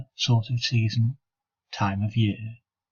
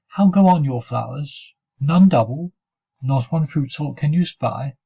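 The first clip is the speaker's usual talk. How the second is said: higher, louder sing-out taunt; mocking taunt; 4 semitones higher, 4 dB louder than usual. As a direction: taunt a rival with mock interest. Taunts rival about his flowers.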